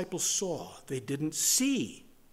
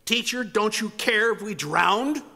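raised, higher plea of disciples; mock scream; 4 semitones higher, 4 dB louder than usual. Disciples crying to their teacher over the spray, mildly screamed. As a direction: cry it out over the storm, mildly. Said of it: "they screamed over the spray".

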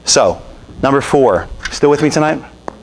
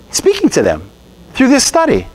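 usual speaking voice

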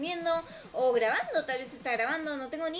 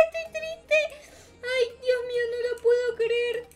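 high, wailing mock sobs; crying; 11 semitones higher, 4 dB louder than usual. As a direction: cry like a telenovela.

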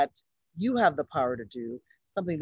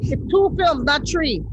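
high, loud mock Jamaican accent; hammed-up Jamaican lilt; 10 semitones higher, 7 dB louder, slower than usual. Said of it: "we're terrible with the Jamaican accents".

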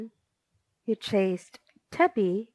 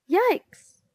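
high, loud yelp of alarm; story-book yell, pitched way up; 10 semitones higher, 5 dB louder than usual. A narrator's reading. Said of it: "Wizard yelled".

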